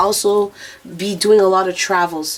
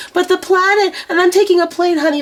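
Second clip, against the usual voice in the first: worried viewer; 9 semitones higher than usual. Retold or imitated.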